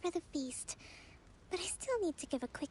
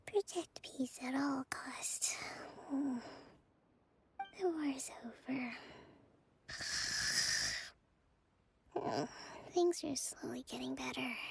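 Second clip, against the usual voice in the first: whispered sleep talk, words drifting; 4 semitones lower, 3 dB quieter than usual. Acted. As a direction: mumble it like you're dreaming. Talking in her sleep.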